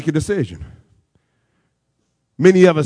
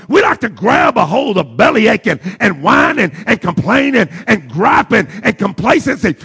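driving sermon cadence with punched key words; full-force preaching; 6 semitones higher, 3 dB louder than usual. Acted. Preacher urging the church to lay sins aside, impassioned preaching. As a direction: preach it hard, rolling through each repetition.

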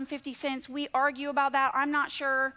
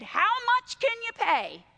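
bank voice; 10 semitones higher, 4 dB louder than usual. Retold or imitated. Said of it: "And they said".

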